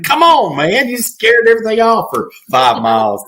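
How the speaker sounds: high-pitched mock exasperation; freaking out. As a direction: imitate a hunter losing his cool, pitch jumping way up. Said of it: "He's, like, freaking out".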